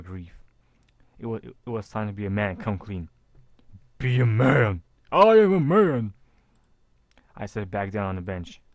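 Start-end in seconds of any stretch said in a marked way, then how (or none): 4.0-6.1 loud, rising self-pep shout. Acted. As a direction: yell it to psych yourself up. Psyching himself up, shouting.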